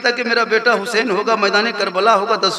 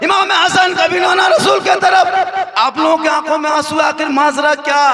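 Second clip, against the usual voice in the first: full-force preaching; 6 semitones higher than usual. Acted. Preacher lamenting to the mourners, high wailing delivery. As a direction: drive the grief home to the crowd.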